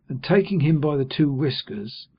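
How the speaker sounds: usual speaking voice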